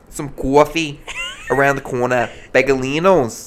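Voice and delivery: hammed-up New York accent; New Yorker accent. Playing along about coffee, hammed-up New Yorker accent. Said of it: "New Yorker".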